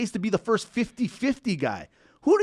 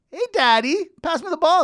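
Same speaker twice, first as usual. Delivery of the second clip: high, pleading mock kid's call; playful high-pitched on-court call; son calling for the ball; 10 semitones higher, 3 dB louder than usual. Plays son asking for ball.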